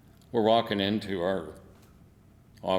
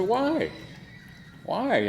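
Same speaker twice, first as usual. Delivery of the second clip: mock indignant why. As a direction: protest like it makes no sense. Retold protest at being called awol.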